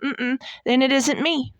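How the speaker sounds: usual speaking voice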